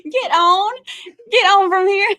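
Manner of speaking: high, loud squeal through real laughter; laughing shoo. Real, not acted. Wife chases husband off her stream, laughing hard. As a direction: shoo him away, barely holding in giggles.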